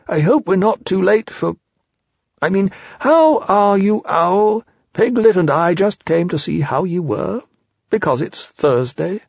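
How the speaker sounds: high, polite bear voice; Pooh, awkwardly polite. Acted.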